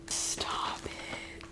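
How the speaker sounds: breathless whisper of delight; awed whisper. Real, not acted. Whispering to viewers over a beautiful old book.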